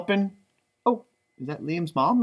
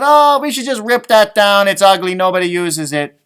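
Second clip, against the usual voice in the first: dismissive people; 3 semitones higher, 9 dB louder than usual. Retold or imitated. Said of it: "And eventually people said".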